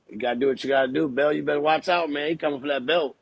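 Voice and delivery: ordinary delivery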